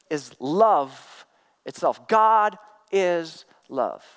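very slow, weighty words; a hushed reverent pronouncement; slow emphatic declaration. Real, not acted. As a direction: lay each word down slowly. Preacher drives home key line.

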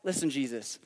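low, soft aside; hushed, confiding lead-in; confidential aside. Acted. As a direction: take someone aside and drop the voice. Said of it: "pulls Jesus aside and says".

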